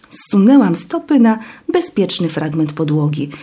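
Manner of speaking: usual speaking voice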